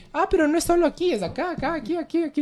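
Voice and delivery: high, urgent voice pointing spot after spot; alarmed doctor. Retold or imitated. Retelling the doctor's exam, imitating him with high, hurried alarm.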